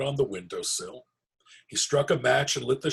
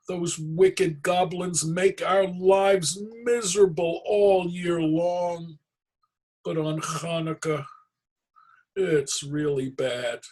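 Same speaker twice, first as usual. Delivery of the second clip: complaining villager; 9 semitones higher, 6 dB louder than usual. Acted. Villager complains to Herschel about goblins, woeful and loud. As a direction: moan about the goblins' cruelty.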